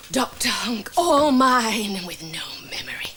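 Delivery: gloating, hushed aside; villainous whisper. Acted. Sister Snide gloating alone over Doctor Hunk, scheming whisper. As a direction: savour it like a secret prize.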